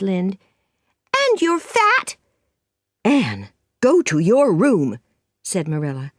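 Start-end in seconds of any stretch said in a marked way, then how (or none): 1.2-2.2 put-on high-pitched insulting jab from a furious girl; Anne, angry. A narrator's reading. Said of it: "Her face was red and angry". 3.0-5.0 put-on loud, firm order to a child; stern Marilla. Marilla orders Anne away.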